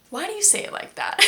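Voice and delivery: high-pitched imitation of a baffled friend; puzzled Italian friend. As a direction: jump up high in mock disbelief. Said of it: "one of my close friends in Italy said".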